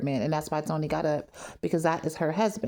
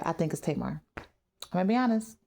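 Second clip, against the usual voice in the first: normal talking voice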